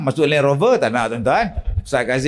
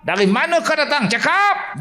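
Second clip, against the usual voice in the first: harsh healer shouting; 11 semitones higher than usual. Retold or imitated. Rough healer interrogating a possessed patient, shouting. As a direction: bark it like a bullying healer. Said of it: "perawat-perawat ni kasar".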